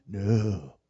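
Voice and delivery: low, soft confiding no; hushed gossip. Retold or imitated. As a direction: drop it low like juicy gossip. Town gossip about Ruth.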